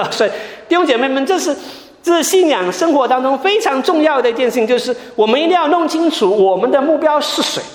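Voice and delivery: rising sermon fervor, pressing the point home; impassioned pastor urging the congregation, pitched high; fervent preaching. Acted.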